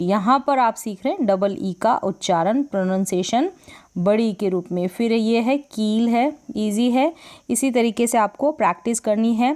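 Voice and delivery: ordinary talk, nothing marked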